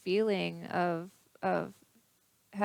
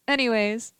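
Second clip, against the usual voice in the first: normal talking voice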